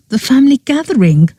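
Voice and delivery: high, fast horrified cry; tones of horror. Acted. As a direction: recoil in horror at the words. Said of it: "I said in tones of horror".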